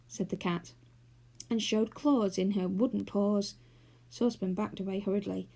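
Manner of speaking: plain reading voice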